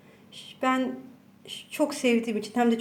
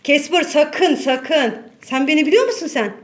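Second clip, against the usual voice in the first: warning the cat; 3 semitones higher, 6 dB louder than usual. Real, not acted. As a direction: drag out the warning, then rush the threat. (kediyi yüksek sesle uyarıyor)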